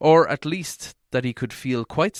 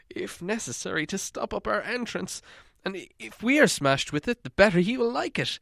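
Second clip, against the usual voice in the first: worried hobbit voice, raised higher; fretful hobbit fearing he said too much; Bilbo; 4 semitones higher than usual. Acted. Hobbit telling dwarves his worry, in a fretful higher voice.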